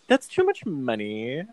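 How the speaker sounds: playful mock protest with swooping pitch; exaggerated, jokey objection. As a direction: tease it out in rom-com banter style. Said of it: "it's played so jokey".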